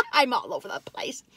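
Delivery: soft whisper after a laugh; sheepish whisper; whispered aside. Real, not acted. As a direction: confess it under your breath.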